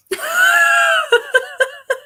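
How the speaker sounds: loud squealing high laugh; shrieking laugh. Real, not acted. Laughing at being molting.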